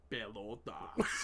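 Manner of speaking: slow, low, drawn-out Spanish word. Shown as a demonstration. Friend asked to say it in Spanish, slow Spanish pronunciation. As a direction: stretch it out in deliberate Spanish. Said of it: "Say it in Spanish".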